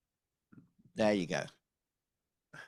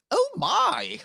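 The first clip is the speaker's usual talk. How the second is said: very high, loud shocked exclamation; hammed-up falsetto gasp of alarm; housekeeper's startled cry; 6 dB louder than usual. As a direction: shriek it as an astonished housekeeper. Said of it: "she just goes".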